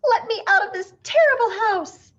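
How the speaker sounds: high, loud panicked shriek. A narrator's reading. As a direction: scream to get out of the house. Hipple still shrieking in panic.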